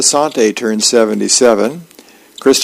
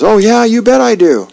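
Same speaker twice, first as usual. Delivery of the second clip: put-on high, louder eager kid voice; 10 semitones higher, 4 dB louder than usual. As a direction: jump at the offer like a keen little boy. Eager kid Elmo answers.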